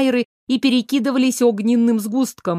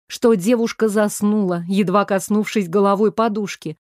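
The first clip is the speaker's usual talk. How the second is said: usual speaking voice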